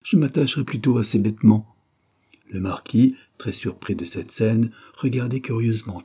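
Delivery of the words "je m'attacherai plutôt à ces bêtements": high, loud desperate protest; affected terror. A narrator's reading. Wife clinging to husband's clothes, feigning terror loudly. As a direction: mount a show of growing fear. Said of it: "affectant une terreur de plus en plus croissante".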